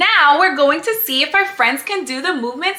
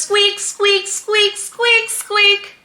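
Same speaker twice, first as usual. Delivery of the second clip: mouse squeaking; 5 semitones higher, slower than usual. Pretend. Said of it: "And she says".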